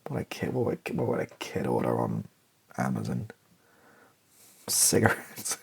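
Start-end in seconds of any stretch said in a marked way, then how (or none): 4.7-5.6 breathed out as a cheeky whisper; whispered answer. Acted. Kid admitting what he'd order, whispered. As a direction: whisper it like a confession.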